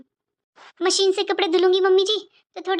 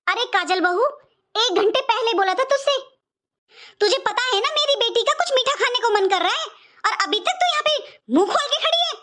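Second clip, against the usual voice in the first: a bossy mother-in-law's voice, pitched higher and nagging; scolding mother-in-law; 4 semitones higher than usual. Acted. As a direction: chew out the daughter-in-law for standing idle.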